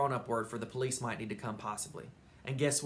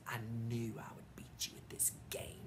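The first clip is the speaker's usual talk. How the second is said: taunting whisper right in his face; 2 semitones lower, 12 dB quieter than usual. Acted. May leaning into Sheldon's face, gloating whisper. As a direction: hiss the gloat close up.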